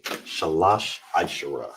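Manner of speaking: Shalash Aishara slow and deliberate; Hebrew thirteen. Shown as a demonstration. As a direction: say Shalash Aishara slowly for students. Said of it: "That's thirteenth".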